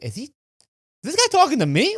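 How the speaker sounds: stunned, pointing at himself; baffled disbelief. Retold or imitated. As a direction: pitch jumps high in confused disbelief. Confused at yelling driver.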